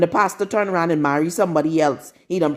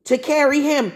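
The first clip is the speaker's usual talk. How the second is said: preached emphasis; 8 semitones higher than usual. Real, not acted.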